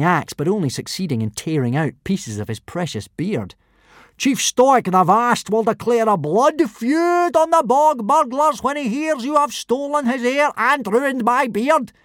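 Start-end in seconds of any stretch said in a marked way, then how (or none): 4.2-11.9 high, loud, slow fury; angry Gobber. A narrator's reading. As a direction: rage about the ruined beard. Gobber furious.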